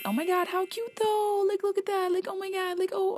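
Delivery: high, soft gushing squeal. Real, not acted. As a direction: coo over the cute photo. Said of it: "I'm just gonna shut up now".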